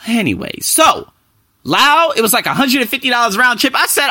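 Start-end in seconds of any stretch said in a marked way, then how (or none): none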